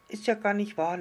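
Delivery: playful denial. A narrator's reading. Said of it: "lachte das kleine Sterndl zurück".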